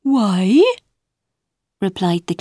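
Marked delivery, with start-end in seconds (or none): 0.0-0.6 high, fussy caterpillar voice; a prim little bug answering back. Acted.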